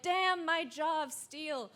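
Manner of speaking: high, slow, lightly mock-proud; a hint of smug bravado; mock boastful sarcasm. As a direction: savour it sarcastically. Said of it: "And I was like".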